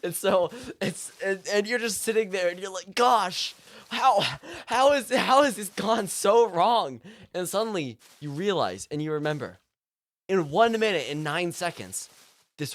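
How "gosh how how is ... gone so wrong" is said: higher, louder, drawn-out lament; mock despair. Distraught customer sitting at the checkout, in mock despair. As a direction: wail it with hammed-up despair.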